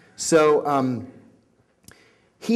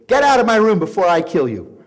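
loud, higher furious threat; angry threat; 5 semitones higher, 8 dB louder than usual. Retold or imitated. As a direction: throw him out with a loud threat. Threatens the beaten guy.

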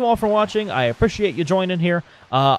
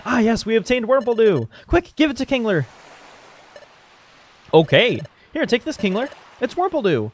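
high-pitched, eager character voice; excited game character; 7 semitones higher than usual. Acted. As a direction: play an eager little hero up high. Voices game characters celebrating the item.

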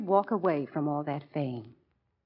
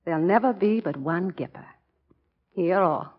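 ordinary delivery

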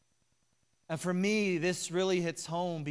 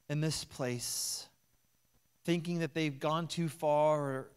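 prayer. Real, not acted. Pastor leads prayer before communion.

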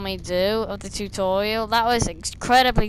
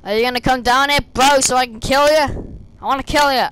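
taunting; 4 semitones higher, 6 dB louder than usual. Real, not acted. Taunting guard to come down.

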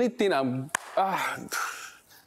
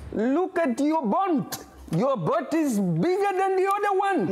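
put-on Indian accent; 7 semitones higher than usual. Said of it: "Indian accent".

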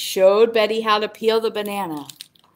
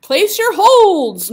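excited; 9 semitones higher, 8 dB louder, slower than usual. Acted. Answers her own quiz.

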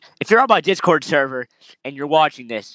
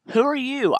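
high character voice; 12 semitones higher than usual. Acted. Voicing characters in game.

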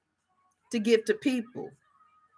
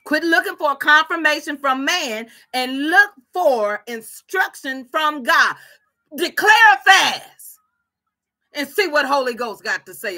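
loud, raised, emphatic exhortation; full-force preaching; 5 semitones higher, 8 dB louder than usual. Real, not acted. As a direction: drive it home to the congregation.

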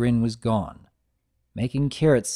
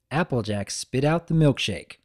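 plain reading voice

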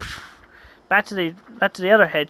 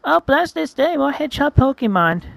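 pitched up, joyful; high delighted voice; 8 semitones higher, 3 dB louder than usual. Acted. Hedgehog greeting the group, high and delighted. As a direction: rejoice at meeting more hedgehogs.

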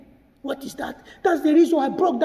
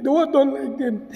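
usual speaking voice

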